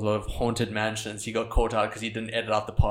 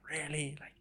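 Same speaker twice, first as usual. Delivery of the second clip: whispered snide comment; 2 semitones higher, 5 dB quieter than usual. Retold or imitated. Voicing a mean comment.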